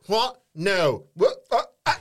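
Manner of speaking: put-on high, loud, laughing drunk babble; mock drunk politician. Mocking Nadine Dorries falling about in Parliament, loud tipsy babble. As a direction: stagger through it like the town drunk. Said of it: "falling about in the Parliament going".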